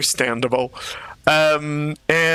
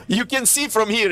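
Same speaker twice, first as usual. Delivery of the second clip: ordinary talk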